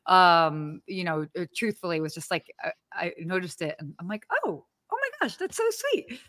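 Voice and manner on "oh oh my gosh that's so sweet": imitating own touched reaction, up high; soft, squeaky-high coo of delight; high-pitched gushing. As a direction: gush sweetly in a high, soft voice. Retelling own reaction to award.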